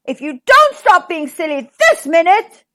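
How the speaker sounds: exasperated, roared threat; roaring. A narrator's reading. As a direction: roar the warning with hard stresses. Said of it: "he roared".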